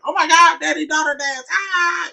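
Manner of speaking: imitating a thrilled child's high squeal; excited youngest daughter. Dad imitating his youngest before the dance, squealing with excitement. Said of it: "they were all excited. The youngest one was like".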